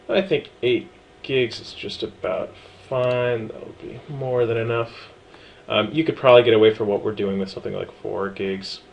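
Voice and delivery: usual speaking voice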